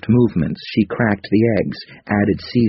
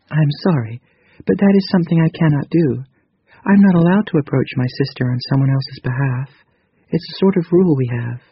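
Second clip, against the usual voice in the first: higher, gentle female character voice; 8 semitones higher than usual. A narrator's reading. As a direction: voice Krita Kano lightly higher. Narrator voices Krita Kano.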